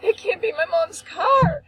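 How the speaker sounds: high, tearful re-enacted wail; retold screaming. Daughter repeating it at sight of the car, screaming. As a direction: wail it in panicked denial. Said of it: "now I'm screaming".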